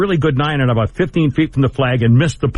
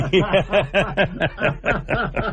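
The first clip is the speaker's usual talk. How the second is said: laughing; 4 semitones higher, 5 dB quieter than usual. Real, not acted. Host laughs at the joke.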